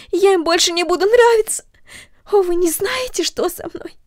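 high, loud, near-tearful begging; a scared girl wanting home; desperate pleading. A narrator's reading. Girl pleads with the mother, high and desperate. Cornelli panicking about her hair.

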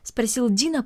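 ordinary delivery

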